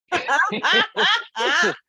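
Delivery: loud high cackling bursts; uproarious laughter. Real, not acted. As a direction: howl with laughter at the joke. Roaring at the Frederick Douglass joke.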